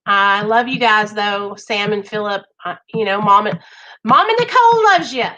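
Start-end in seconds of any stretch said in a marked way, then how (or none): 4.0-5.2 high, loud affectionate holler; exuberant affection. Real, not acted. Host sending Mom's love to her boys, exuberant holler. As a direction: call out the love, pitch soaring. Cheering affection to absent friends.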